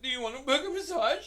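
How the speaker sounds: high, loud mock offer; shouted sales pitch. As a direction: yell it out as a joke suggestion. Suggests a pitch she should have yelled.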